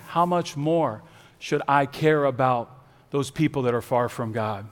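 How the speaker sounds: soft, low, patient questioning; loving, heavenly gentleness. Acted. Preacher as loving Heavenly Father to Jonah, soft and low. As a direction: speak gently, with pauses, as a tender father. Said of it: "comes at him in a loving Heavenly way".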